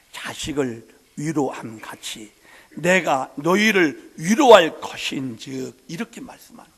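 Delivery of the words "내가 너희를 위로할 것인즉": reading scripture. Acted. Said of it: "이렇게 표현을 해요".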